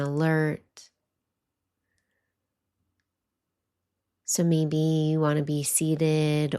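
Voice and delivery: calm meditation voice with long pauses; guided meditation. Acted. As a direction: guide listeners gently, leaving space between phrases.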